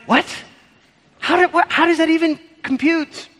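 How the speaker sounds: high-pitched disbelieving "what?"; mock disbelief. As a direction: sound like a puzzled listener who can't follow. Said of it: "You're like".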